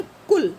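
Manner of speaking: कुल loud, with a quick short u. Shown as a demonstration. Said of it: "छोटे ऊ की कैसे बोलेंगे?".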